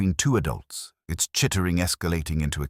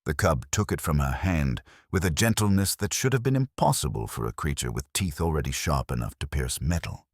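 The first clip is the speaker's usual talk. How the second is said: ordinary delivery, nothing marked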